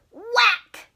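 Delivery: sudden shouted whack; loud whack. A narrator's reading. Teacher reading to kids, a loud whack. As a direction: punch out the sound word high and loud. Sound word read with gusto.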